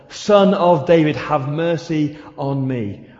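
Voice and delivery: loud, drawn-out cry for mercy. Acted. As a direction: shout it slowly, stretching each word. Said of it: "he shouts even louder".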